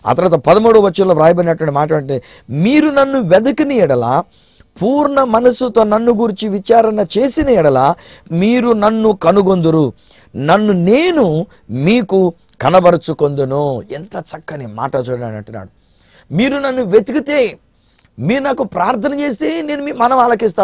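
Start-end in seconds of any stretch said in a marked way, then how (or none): 2.5-13.8 high, slow, ringing proclamation of scripture; solemn scripture reading. Acted. Preacher to viewers, proclaiming God's promise high and slow. 16.3-20.6 soar up on the promise; fervent proclamation. Acted. Preacher to viewers, repeating the promise in a soaring voice.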